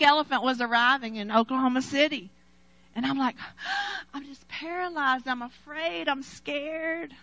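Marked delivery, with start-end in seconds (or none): 3.4-4.1 sharp fearful gasp in. Retold or imitated. Dread of facing the problem.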